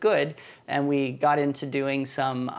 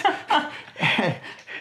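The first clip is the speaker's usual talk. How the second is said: breathy, unvoiced burst of laughter, falling away; real laugh; 8 semitones higher than usual. Real, not acted. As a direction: let out a real airy laugh at the joke. Laughs after joke about arms.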